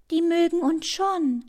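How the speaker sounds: high-voiced, indignant objection; put-out butterfly, pitched high; protesting. A narrator's reading. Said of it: "protestiert der Schmetterling".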